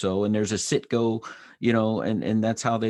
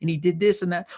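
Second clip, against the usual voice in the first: high chatty back-and-forth; lightly voiced wife and eager reply; 9 semitones higher, 3 dB louder than usual. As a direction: report the flight gossip eagerly. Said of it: "she's like".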